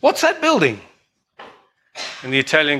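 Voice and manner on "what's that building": much higher-pitched mock demanding question; hammed-up tourist, voice pitched way up; brash American visitor. American tourist demanding answers of his Italian host, hammed-up. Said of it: "The American guy goes".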